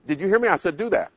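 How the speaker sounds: lightly voiced impatient parent; nagging parent. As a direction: press the child to listen. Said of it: "finally the parent's yelling and screaming".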